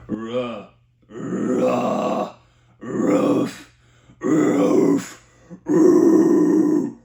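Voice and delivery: distorted barks on the ooh; barked scream on ooh. Shown as a demonstration. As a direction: lean into the ooh while barking. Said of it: "heavily focusing on that U".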